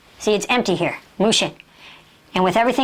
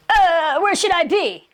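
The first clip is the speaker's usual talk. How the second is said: high, hammed-up flustered voice; long startled "uh", then a fretful question; mock panicked dithering; 5 semitones higher, slower than usual. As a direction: imitate someone thrown into confusion. Acts out programmed dithering.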